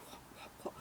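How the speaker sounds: imitating a silent question across the room; barely audible, voiceless whisper; mouthed whisper. Friend signalling across the pub.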